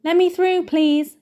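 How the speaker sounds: put-on head boy calling to clear the crowd; Percy pushing through. A narrator's reading. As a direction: bustle forward importantly. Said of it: "came Percy's voice".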